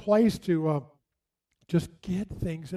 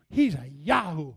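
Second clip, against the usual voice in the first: mother's dismissive aside; 3 semitones lower than usual. Retold or imitated. Mother disparaging her pastor.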